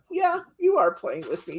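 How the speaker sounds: teasing pup's thought; voicing the dog. Owner voicing Teddy teasing Bryce, high and loud. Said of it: "Teddy thought".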